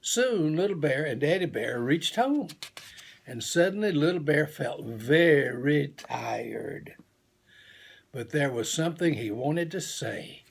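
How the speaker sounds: ordinary delivery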